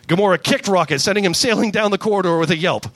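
ordinary talk

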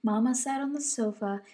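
ordinary delivery

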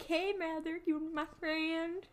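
mock Southern accent. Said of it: "I always default to a Southern accent".

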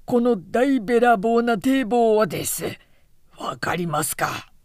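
hoarse outburst, high and loud, then a husky question; straining hoarse voice. A narrator's reading. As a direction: force the rasp up in emphatic outrage, then drop. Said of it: "大六はしゃがれ声を励ましていった". (しゃがれ声を張り上げて強調)

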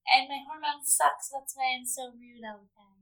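lightly nasal mocking confession; whiny, nasal mock of a rude woman; Jessica impression. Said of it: "Jessica impression".

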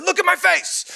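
high-pitched playful holler; mock yell. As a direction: yell it at a child who looked away. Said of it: "Kinda yelled at him again".

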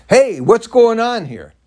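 loud, slow, high call-out; boisterous yell. Retold or imitated. Man startling the sheriff from behind, boisterous yell. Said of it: "I yelled out really boisterously and loudly".

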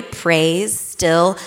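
ordinary talk, nothing marked

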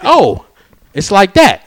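loud, high retold challenge; affronted customer. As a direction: act out an offended comeback, voice way up. Customer's offended comeback, acted out.

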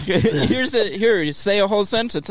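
mock his pushy instructions through laughter; laughing mock imitation. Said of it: "You were like".

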